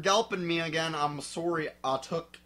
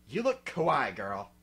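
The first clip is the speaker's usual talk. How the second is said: ordinary delivery, nothing marked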